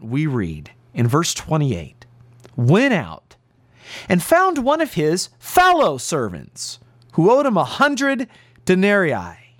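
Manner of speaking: normal talking voice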